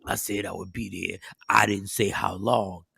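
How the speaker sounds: low, soft, slurred sleepy mumble; groggy drugged voice. Retold or imitated. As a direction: sound doped up on painkillers. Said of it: "He says".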